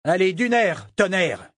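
ordre crié, plus aigu et plus fort; hurlement. A narrator's reading. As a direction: Brachen hurle pour encourager ses hommes. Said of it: "hurla Brachen". (hurlement de capitaine)

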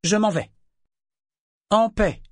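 high, loud parting declaration; firm announcement. A narrator's reading. Althea leaving the council peacefully, firm announcement. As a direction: announce the exit clearly to the room. Announces her departure to the hall.

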